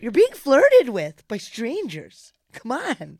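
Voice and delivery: exasperated friend urging him to be nice; mock scolding. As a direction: chide him loudly in a high voice. Scolding the uncomfortable boyfriend.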